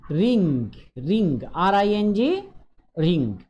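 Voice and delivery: "रिंग" said slowly with a clear final ng, then spelled letter by letter; pronunciation drill. Shown as a demonstration. Teacher modelling "रिंग" for pupils, slow and clear. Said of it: "यहाँ पे pronounce करना सीखना है".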